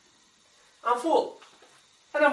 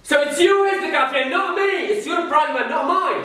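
loud, raised-pitch accusation; angry blaming; 7 semitones higher, 6 dB louder than usual. Acted. Husband blames wife for their problem, angry and loud. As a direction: throw the blame back forcefully.

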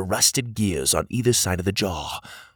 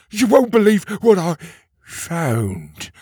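excited; 8 semitones higher, 5 dB louder than usual. A narrator's reading. Petey rushing over excited.

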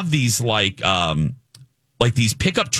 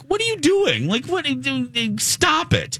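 much higher, exasperated "what are you doing"; exasperated outburst. Retold or imitated. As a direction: shriek at the rude teens to stop, in disbelief. Retold outburst at teenage boys.

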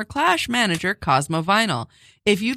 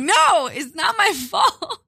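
laughing; 8 semitones higher, slower than usual. Real, not acted. Giggling fit while reading ad.

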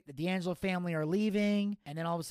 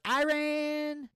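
high, held one-word cheer; Gator; 11 semitones higher, 3 dB louder than usual. Retold or imitated. Friend cheering the champion's win, high put-on cheer. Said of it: "Gator goes".

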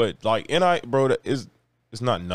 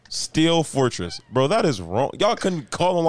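ordinary talk, nothing marked

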